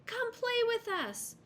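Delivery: put-on very high, slow boyish call; shouting boys. A narrator's reading. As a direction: call out across the river. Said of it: "the boys shouted".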